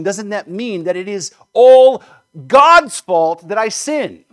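loud, slow, indignant question rising to a cry; accusing outcry. Acted. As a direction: throw the accusation at God, drawing out the last words.

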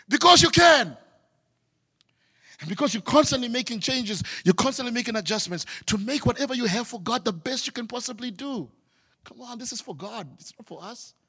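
full-force sermon that fades out; fiery preaching. Acted. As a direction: preach it hard, then pull back to a hush.

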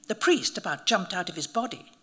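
ordinary delivery, nothing marked

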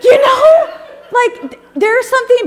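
high laughing voice. Real, not acted. Speaker cracking up about dads pouring milk, high laughter. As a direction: break up laughing, pitch jumping up. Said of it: "laughing hard".

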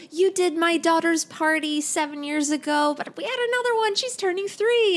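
very high-pitched imitation of a parent; gushing client parent. Returning client to party princess about another child, gushing high. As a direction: pitch it way up, mimicking an eager client.

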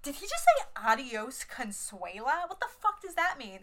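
high, incredulous question; mock confused outrage; baffled disbelief. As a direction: ask it like you can't believe your ears. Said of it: "I was like".

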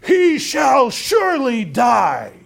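slow, high, loud outburst; acted furious shout of a death sentence; furious king. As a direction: thunder it out like an enraged king. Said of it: "he explodes in fury".

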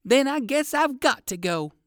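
high, loud, resigned exclamation; exclaiming. A narrator's reading. Rabbit gives in to the dog soldier, exclaiming. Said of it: "exclaimed Uncle Wiggily".

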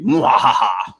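cackling mwahaha evil laugh; villain cackle, played for fun; mad scientist laugh. Evil laugh after reading the quote.

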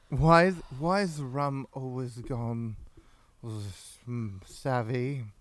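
put-on slow, drunken British pirate; slow mock-British buccaneer; Jack Sparrow. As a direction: draw it out like a tipsy pirate. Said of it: "Tammy loves Jack Sparrow".

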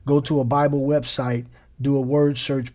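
normal talking voice

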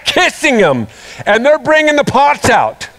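usual speaking voice

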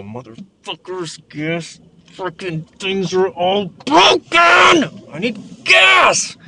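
slow shouted rant, rising very high on I need gas; furious at broken gas pumps; shouting at the pumps; real angry outburst. Real, not acted. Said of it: "See, I am mad".

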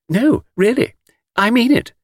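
put-on high, girlish earnest insistence; insistent admirer. A narrator's reading. Miss Taylor insisting to Mr Cade, earnest. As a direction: press the compliment in a high voice.

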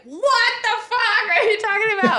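high, laughing what-the-hell cry; mock outrage through laughter; laughing disbelief. Group retold disbelief.

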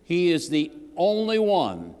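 slow, loud, drawn-out proclamation; fervent preaching. Acted. Preacher to his flock, building slow and loud about Christ. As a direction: lean on each phrase and let it ring.

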